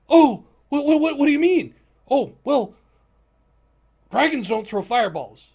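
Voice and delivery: high, loud what-what-what; shocked disbelief. Real, not acted. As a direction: react stunned to the twist. Players shocked by twist.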